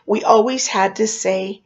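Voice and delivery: usual speaking voice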